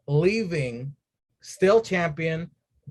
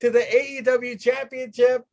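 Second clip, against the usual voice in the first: much higher, gleeful rave; overjoyed gush; 10 semitones higher than usual. Retold or imitated. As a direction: gush like it's Christmas. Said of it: "I was like".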